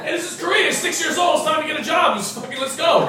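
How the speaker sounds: hammed-up parent barking at a six-year-old; a pushy parent hurrying a child to work; mock pushy Korean parent.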